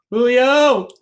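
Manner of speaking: high, loud, drawn-out name call; hype-up holler, stretched out; excited name announcement. Real, not acted. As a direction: sing out the player's name like a big reveal. Announcing co-host's favourite player.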